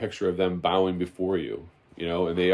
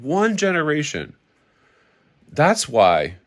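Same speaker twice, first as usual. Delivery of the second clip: raised, insistent sermon voice; fiery preaching; 4 semitones higher, 4 dB louder than usual. Acted. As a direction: preach it to the congregation with fire.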